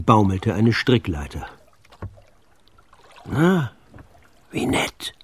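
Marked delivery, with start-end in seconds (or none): 3.2-5.1 dry whispered aside. A narrator's reading. Sven whispering at the dangling ladder. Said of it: "flüsterte der dicke Sven".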